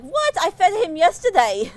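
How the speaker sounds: the boss can't believe the snake is hungry; boss's disbelief. Retold or imitated. Boss reacting to the snake biting, a high startled outburst. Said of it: "my boss is like".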